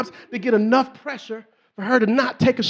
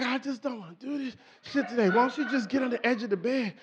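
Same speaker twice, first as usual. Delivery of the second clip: reluctant grumble; 10 dB quieter than usual. Retold or imitated. Husband dodging effort in bed, soft reluctant whine. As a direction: say it quietly, as if worn out. Imitates the reluctant side of a man.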